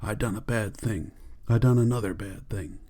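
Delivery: hushed, understated fright; frightened whisper. A narrator's reading. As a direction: softly voice a frightened whisper, as a narrator would. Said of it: "he whispered in fright".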